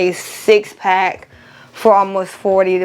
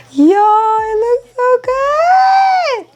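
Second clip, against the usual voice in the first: excited high squeal; 14 semitones higher, much slower than usual. Real, not acted. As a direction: shout with delight. Admiring finished tumbler.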